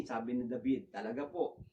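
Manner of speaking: normal talking voice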